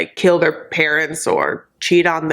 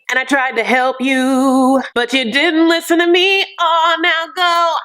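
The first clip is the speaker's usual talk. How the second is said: hammed-up much higher send-off; mock scolding; 8 semitones higher than usual. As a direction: dismiss him with a sassy flourish. Voicing Avery telling Ash off.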